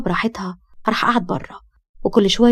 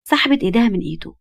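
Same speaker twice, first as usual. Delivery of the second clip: ordinary delivery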